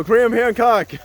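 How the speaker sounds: loud, high yell from the audience; re-enacted heckler's shout; retold call-out. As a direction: holler the name at the stage. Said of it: "And I just go".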